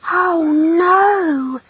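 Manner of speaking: high, wailing dismay; high-pitched motherly lament. Acted. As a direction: breathe out horrified disbelief in a high voice. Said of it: "she whispered".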